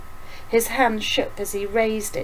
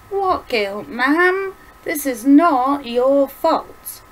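raised, emphatic, concerned protest; 6 semitones higher, 8 dB louder, much slower than usual. A narrator's reading. William tells his mother she is blameless, concerned and emphatic. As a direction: insist on it, loud and slowing. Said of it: "William said, concerned".

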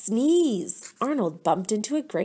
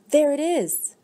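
gasp; 6 semitones higher, 5 dB louder than usual. A narrator's reading. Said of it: "she gasped".

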